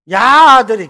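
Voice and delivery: a bus passenger teasing in Gyeongsang dialect. Retold or imitated. Passenger teasing the sooty boys, Gyeongsang dialect. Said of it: "경상도 말로".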